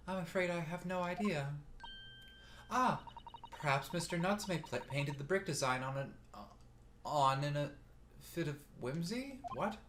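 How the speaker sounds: put-on lifted, light girlish read. Same female character higher.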